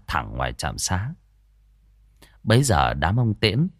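lively village ghost-story telling; storyteller voice for a spooky folk tale; story narration. A narrator's reading. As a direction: draw the listener into the scene.

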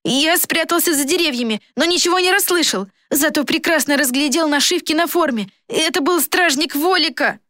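put-on high-pitched, breathlessly eager boy; gabbling boy. A narrator's reading. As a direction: rattle off the report in a high boyish voice. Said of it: "затараторил ещё быстрее". (высокий мальчишеский голос, тараторит взахлёб)

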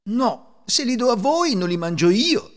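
high, loud refusal; sharp refusing woman. A narrator's reading. Woman turning away a poor beggar asking for chickpeas, sharp refusal. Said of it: "disse la donna".

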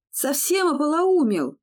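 loud, high furious yell; angry bark. A narrator's reading. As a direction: bark at him in anger. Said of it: "рявкнул Тай".

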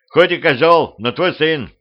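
loud, high-pitched retort; shouting. A narrator's reading. Petrovich still arguing while swinging his sword, shouting. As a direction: keep yelling mid-fight. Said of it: "крикнул Петрович".

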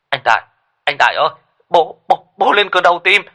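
a relative screaming the news down the phone; frantic panic. A narrator's reading. Frantic phone call, father dying. (hoảng loạn, gào lên mỗi lúc một cao)

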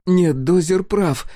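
put-on high, loud fervent child; fervent girl. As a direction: back up her friend passionately. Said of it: "с жаром поддержала друга Сол".

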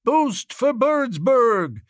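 slow, high chorus of devotion; reverent. A narrator's reading. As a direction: echo the slogan reverently, drawing it out.

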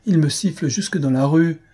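put-on a young actress bristling at the hecklers' whistles; angry. A narrator's reading. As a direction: fling the question out, stamping with anger. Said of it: "dit-elle en frappant du pied".

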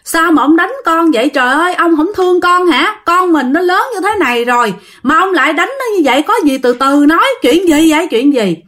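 high-pitched pleading protest; acted distressed wife intervening; mother crying out. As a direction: plead with him like an alarmed mother. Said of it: "mẹ mới la làng lên, mẹ can".

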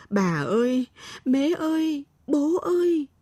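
high, drawn-out calls for family; frightened calling out. A narrator's reading. Lost girl calls grandmother and parents in the forest, frightened calling. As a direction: call out for help, pitch climbing. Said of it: "vừa chạy vừa gọi".

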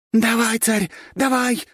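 high, loud urging; frantic high-pitched supporter's shout; relentless cheering. A narrator's reading. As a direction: keep egging your champion on. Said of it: "не унималась фея".

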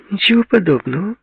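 very high, indignant retort; almost squeal it in protest; near screech. A narrator's reading. Jilted girl contradicts Paul, nearly screeching. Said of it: "едва не сорвалась на визг".

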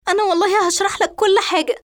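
high, fast, crying apology; acted sobbing plea, rushed and high; tearful pleading. A narrator's reading. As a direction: beg forgiveness through tears. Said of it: "فتبكي إسراء وتنظر له باستعطاف".

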